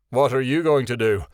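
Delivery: a boorish scoffing question. A narrator's reading. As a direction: challenge her rudely. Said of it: "Kasif said boorishly".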